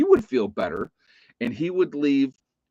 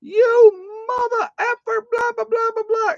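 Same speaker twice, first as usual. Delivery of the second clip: grandpa cursing, mimicked very high and louder; angry cursing; 5 dB louder than usual. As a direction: shout a hammed-up curse after him. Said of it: "cursing me".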